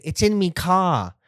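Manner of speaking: slow mock British "in me car"; British accent. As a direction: mimic the British "It's in me car". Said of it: "They say".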